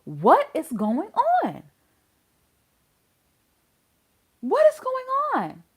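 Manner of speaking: high, drawn-out incredulous question; slow, high-pitched exasperation; exasperated disbelief. Real, not acted. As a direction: stretch it out, utterly baffled.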